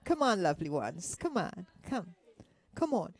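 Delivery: ordinary delivery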